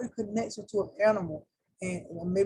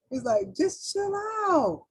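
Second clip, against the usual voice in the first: high slow chill; 10 semitones higher, slower than usual. Acted.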